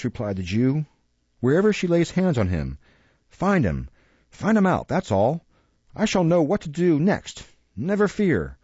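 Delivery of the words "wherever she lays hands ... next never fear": put-on high, wheedling old schemer in a hurry; anxious old fence urging his gang. A narrator's reading.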